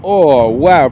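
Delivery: loud delighted gasp of wonder; excited wow. Real, not acted. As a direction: react to the landing with real awe. Watching a smooth landing.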